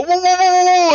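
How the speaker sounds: high, loud alarmed shout; guards shouting. Acted. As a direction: call out to stop them. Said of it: "these guards kinda go".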